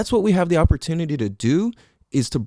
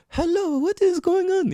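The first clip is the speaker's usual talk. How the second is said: much higher, sing-song mock greeting. Host mocking canned greetings, high and playful. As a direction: squeak it out as a silly hello. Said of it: "however the fuck you talk".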